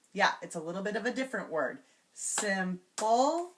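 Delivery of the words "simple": SIMPLE in two beats, ending on a rise; syllable by syllable. Shown as a demonstration. Teacher repeating the word to change, Simple split. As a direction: separate Simple into sim and pul. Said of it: "change the final syllable".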